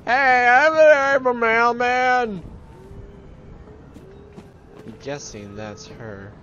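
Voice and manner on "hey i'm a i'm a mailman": high, loud drawn-out holler; calling out. Pretend. Mailman hails someone in game, loud calling out. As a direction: announce yourself at the top of your voice.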